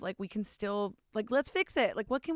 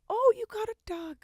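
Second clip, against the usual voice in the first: high, bright burst at seeing the dog; sudden delight at the dog; 12 semitones higher than usual. Real, not acted. Guest spots the host's dog and coos in surprise. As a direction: squeal a little at the puppy. Sees the dog, baby talk.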